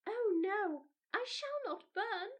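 put-on high, loud child's protest; a small girl insisting she is safe; Mildred cries out in protest; protesting little girl. A narrator's reading. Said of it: "cried Mildred".

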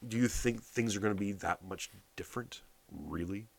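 ordinary talk, nothing marked

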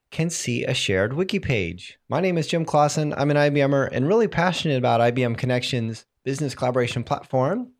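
ordinary talk, nothing marked

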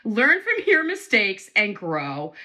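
ordinary talk

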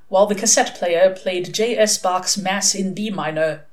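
plain reading voice

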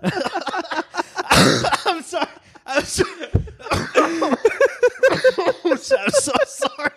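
high, wheezing apology through laughs; helpless laughter. Real, not acted. Friend cracks up at the fentanyl search, laughing hard. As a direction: apologize while you can't stop laughing. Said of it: "I don't mean to laugh".

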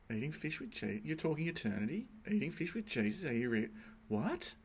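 quiet, incredulous questions rising; shocked disbelief. Retold or imitated. Preacher recalling his shock at the lecturer, incredulous. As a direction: replay the shock, baffled. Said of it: "just being shocked".

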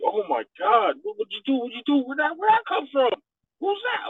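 high excited clubgoer voice; mock amazed partygoer; amazed crowd. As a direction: gush like a thrilled fan. Crowd reacting to the remix.

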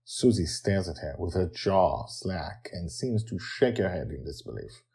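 plain reading voice

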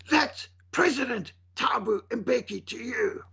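drawn-out mock outrage, pitched high; mock indignant scream. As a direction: imitate an outraged scream at reduced volume. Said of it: "the man screamed out".

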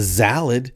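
"salad" said as "zalad". Acted. Mocking the restaurant's names, "salad" with a Z. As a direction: start "salad" with a buzzing Z. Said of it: "they put a Z in front of everything".

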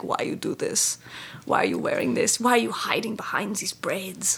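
put-on hushed, accented questioning; a hint of a puzzled German woman, half-whispered; quizzical German woman. German woman questioning her costume.

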